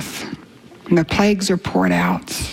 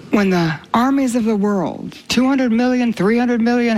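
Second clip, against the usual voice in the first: ordinary talk, nothing marked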